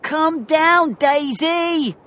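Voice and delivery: high, loud single-voice call; Danny crying out. A narrator's reading. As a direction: shout up to someone far above. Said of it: "cried Danny".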